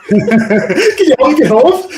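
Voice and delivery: slow, high, loud mock wail; exaggerated high-pitched imitation of someone begging; hammed-up plea. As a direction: drag out the mimicked plea, laughing. Co-host echoes the quoted line exaggeratedly.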